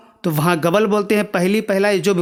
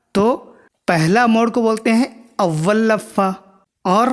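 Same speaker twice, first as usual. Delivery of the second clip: normal talking voice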